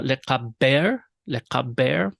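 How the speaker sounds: le kaber said slowly, each sound clear; careful Hebrew reading. Shown as a demonstration. Teacher demonstrating Hebrew word to class, careful and slow. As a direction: model le kaber for students to repeat. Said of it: "or to bury en masse".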